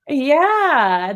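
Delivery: ordinary talk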